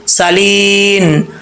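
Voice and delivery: सालीन slowly, ending on a still नून; tajweed pause; stop on silent noon. Shown as a demonstration. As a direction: close सालीन on a resting noon. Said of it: "नून को साकिन कर देंगे".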